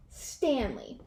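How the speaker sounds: usual speaking voice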